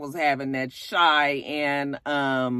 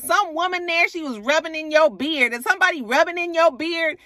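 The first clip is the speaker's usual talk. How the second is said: high, pressing accusation; accusing girlfriend; 10 semitones higher than usual. Retold or imitated. Sierra confronting boyfriend on the phone, high accusing tone. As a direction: demand answers from a cheater.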